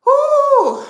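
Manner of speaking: high, loud "whoo"; heated whoop. Real, not acted. Woman venting at her critics on a livestream, loud heated whoop. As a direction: let off steam with a sudden high whoop. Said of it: "I'm getting heated".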